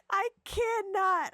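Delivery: high, laughing disbelief; can't stop laughing. Real, not acted. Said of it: "laughing at the game".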